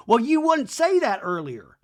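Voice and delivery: high, loud retort; hammed-up indignant comeback; mock accusing. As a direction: fire it back in an imitated squabble. Acted quarrel, Brittany's retort.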